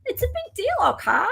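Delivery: very high, swooping mock protest. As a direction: pitch it way up, then drop on okay. Repeats line in mock voice.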